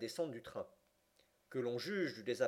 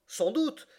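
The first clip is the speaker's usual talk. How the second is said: high, loud, impetuous exclamation; impetuous cry; 11 semitones higher, 7 dB louder than usual. A narrator's reading. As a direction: burst out with it impetuously, pitched well up. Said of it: "s'écria impétueusement Passepartout".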